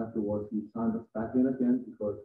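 normal talking voice

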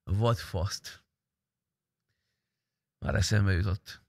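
normal talking voice

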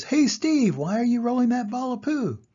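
high, loud hail then question; curious crocodile. Acted. Crocodile calls to Steve, high and loud. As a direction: shout the name up high. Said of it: "The crocodile says".

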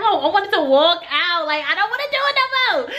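high, fast mock whine; so nervous. Nervous auditioner wanting out, high mock whine. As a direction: whine it like someone desperate to back out. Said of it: "I'm, like, so nervous".

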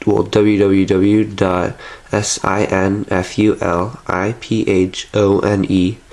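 ordinary talk

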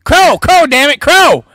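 annoyed shout. Acted. Character insists on his name.

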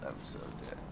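a drained breathy gasp of defeat; whisper. Real, not acted. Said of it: "My voice is dead".

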